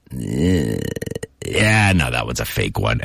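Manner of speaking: judge the cry and shrug it off; knowing dismissal. Retold or imitated. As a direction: parent who knows a fake cry. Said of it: "And you go".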